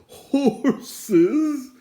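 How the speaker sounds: high, loud, wailing cry; a sobbing high wail at the horses; sobbed. A narrator's reading. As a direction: wail it in dismay. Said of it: "he sobbed".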